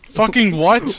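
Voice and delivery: loud, laughing disbelief; shocked laughing. Real, not acted. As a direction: shout it in shocked amusement. Reacting to the gory picture.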